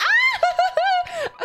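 high, loud squealing laugh; shrieking laugh. Real, not acted.

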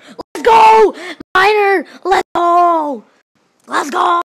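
full high shout of excitement; loud, high, elated hollering; triumphant cheering. Real, not acted. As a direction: yell it like a winning fan. Cheering the Miner in game.

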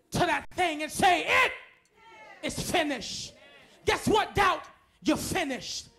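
loud, rising sermon shouting over the music; preaching at full force. Acted. Preacher shouting down fear to the congregation, at full force. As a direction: preach it hard, each line hammered out.

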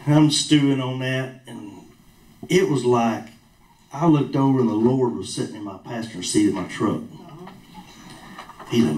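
ordinary talk, nothing marked

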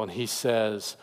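ordinary talk, nothing marked

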